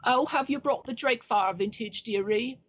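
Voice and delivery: put-on high, sweet old woman asking the wagoner; a portly old servant cooing at the deliveryman. Old woman asks wagoner about the wine, sweetly high. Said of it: "she says".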